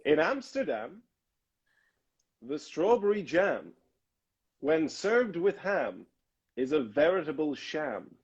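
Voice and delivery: slow, over-enunciated vocal drill; deliberate, sing-song demonstration; tongue-twister recitation. Shown as a demonstration. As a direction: recite it phrase by phrase, stretching each rhyme. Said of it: "a tongue twister".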